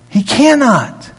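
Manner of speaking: slow, firm cannot; stress on cannot. Shown as a demonstration. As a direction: lean hard on cannot. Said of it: "I just can't emphasize enough that word cannot".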